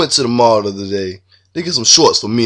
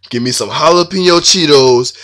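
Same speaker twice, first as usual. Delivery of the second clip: ordinary talk, nothing marked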